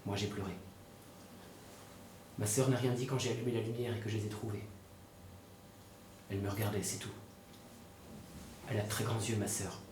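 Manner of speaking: low, soft monotone recollection; stunned, numb. Acted. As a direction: recount it haltingly, in shock, with long pauses. Stunned brother recounts what he saw. (récit sous le choc, voix basse et éteinte)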